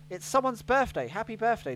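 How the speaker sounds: ordinary delivery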